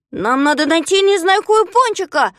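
high, loud call across a distance; Знайка кричит в ответ. A narrator's reading. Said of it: "закричал в ответ Знайка". (громкий высокий крик в ответ)

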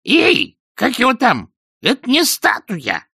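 very high parrot squawk; high-pitched, loud bird voice; hoarse parrot cry. A narrator's reading. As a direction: call out sharply like a parrot. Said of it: "хрипло выкрикнул".

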